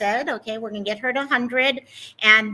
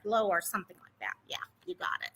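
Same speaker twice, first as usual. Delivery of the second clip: unvoiced whisper to someone off camera; whispered aside; 5 semitones lower, 10 dB quieter, much faster than usual. Real, not acted. Streamer whispering to a helper about the camera angle, hushed. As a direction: whisper the instructions so chat barely hears.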